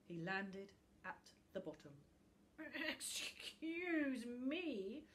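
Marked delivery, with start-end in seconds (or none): 2.9-5.0 put-on a slow, creaky old book; rusty, dusty voice. A narrator's reading. An old book complains to the intruder, rusty and dusty. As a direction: drag it out, dry and dusty. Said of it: "said a rusty, dusty voice".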